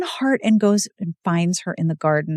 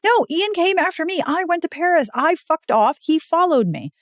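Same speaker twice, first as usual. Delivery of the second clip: mock-indignant, pitched high; a lightly voiced protesting Beth; 9 semitones higher than usual. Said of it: "she's like".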